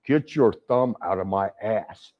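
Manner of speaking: slow, low retort in a lightly put-on wrestler voice; lightly voiced wrestler, taking his time; imitating Hulk. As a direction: draw it out, low and deliberate. Quoting Hulk's slow retort.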